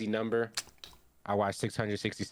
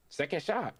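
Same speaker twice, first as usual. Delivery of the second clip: retold call of the move, raised pitch, falling end; announced attack; 6 semitones higher than usual. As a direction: state it as a declaration, pitched up. Acted quote, character announces attack.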